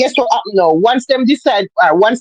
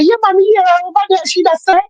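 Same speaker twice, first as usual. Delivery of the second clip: higher-pitched mock gossiper; gossipers agreeing; 7 semitones higher, faster than usual. Gossipers telling others what she supposedly said, higher-pitched imitation. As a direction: imitate a tattler confirming it, pitched up. Acting out gossipers who spread lies.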